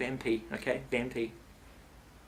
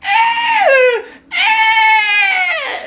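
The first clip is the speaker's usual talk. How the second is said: high, loud screams; panicked high-pitched screaming; girlish scream; 15 dB louder than usual. Retold or imitated. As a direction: scream like a little girl. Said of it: "screaming like a little girl".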